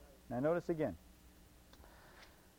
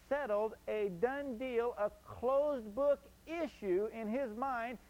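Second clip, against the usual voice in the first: preaching; 5 semitones higher, slower than usual. Acted. Preaching slowly.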